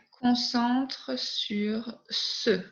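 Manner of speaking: unvoiced whispered aside. Real, not acted. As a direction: whisper it to yourself. Teacher drops to whisper. (chuchoté)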